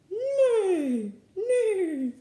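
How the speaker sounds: loud, high, falling whinny; horse neigh. Shown as a demonstration. Reader guessing a horse-like zebra sound, neighing. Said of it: "what I think a horse sounds like".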